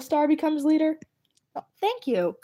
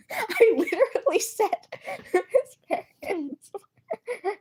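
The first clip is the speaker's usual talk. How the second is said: higher, laugh-choked disbelief, drawn out at the end; exasperated laughing whine; 9 semitones higher, slower than usual. Real, not acted. Streamer to chat who guessed slowly, laughing in exasperation. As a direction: whine it again through laughter, the last words stretched slowly.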